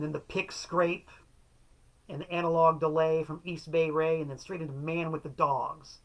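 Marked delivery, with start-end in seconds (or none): none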